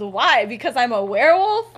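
loud, exasperated retort; snapping. Acted. Remus to his overprotective dad, snapping. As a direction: snap it back as a challenge. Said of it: "Remus just snaps and he goes".

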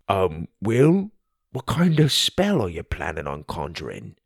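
lightly voiced friend, hesitant; wary Tim. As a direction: ask it warily as Tim. Tim getting serious.

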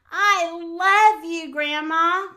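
loud, high, drawn-out storybook yell. A narrator's reading. Little Bear happily tells Grandma he loves her, yelling. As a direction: belt out the affection slowly. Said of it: "Little Bear yelled".

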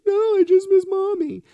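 put-on very high, loud childlike wail; imitating upset daughter. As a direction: mimic a tearful little girl at full pitch. Voicing his daughter's upset answer.

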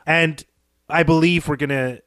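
ordinary delivery

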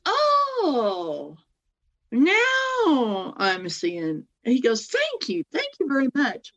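drawn-out high oh of understanding; high relieved grateful doctor; sudden realisation. Retold or imitated. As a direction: let it dawn on him slowly.

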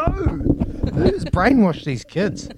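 mock outrage. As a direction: ham up the betrayed dad. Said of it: "I'm like".

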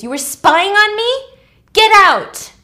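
high, loud acted outrage; outraged scream. Acting Claire screaming at the man who spied, outraged. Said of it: "And she screams".

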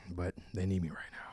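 whispered. Acted. Character whispers, narration names it.

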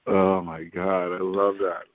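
usual speaking voice

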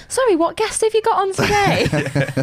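high, slow mock disbelief with a laugh; baffled listener. Host voices listeners shocked by the guest's swearing, mock-baffled. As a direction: play an appalled listener, pitched well up. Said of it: "People are gonna be listening ... being like".

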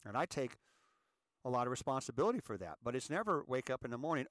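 ordinary talk, nothing marked

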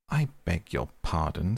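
usual speaking voice

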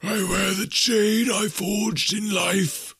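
slow, high wailing phantom voice; mournful ghost. A narrator's reading. Ghost telling Scrooge of its doom, mournful wail. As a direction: swell into a haunted lament.

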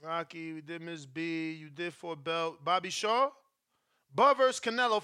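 normal talking voice

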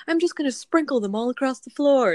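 high, loud teasing voice; playful husband voice. Retold or imitated. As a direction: ham up the husband's tease. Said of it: "he was like".

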